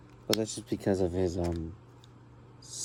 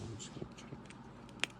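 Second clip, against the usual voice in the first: whisper; 19 dB quieter than usual. Real, not acted. Fiddling with figure, family asleep.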